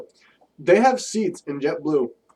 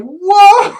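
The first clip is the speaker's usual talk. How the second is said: shocked whoa; 11 dB louder than usual. Retold or imitated. Shocked reaction to inspector's remark.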